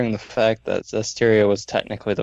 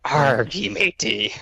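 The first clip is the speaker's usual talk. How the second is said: pirate talk; 4 semitones higher, slower than usual. Retold or imitated. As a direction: ham up a cartoon pirate. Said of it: "he talks like a pirate".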